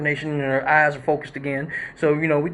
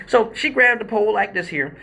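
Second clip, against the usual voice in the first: normal talking voice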